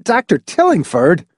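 high, urgent shout of a name. A narrator's reading. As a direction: call him out sharply, pitched high. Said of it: "he shouted".

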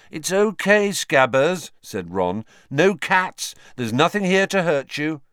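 put-on high boy's voice, calming but tense; Ron reassuring his rat. Ron soothes the frightened rat.

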